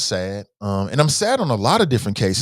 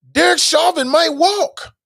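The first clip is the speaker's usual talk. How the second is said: anguished fear; 5 dB louder, slower than usual. Real, not acted. Fearing verdict.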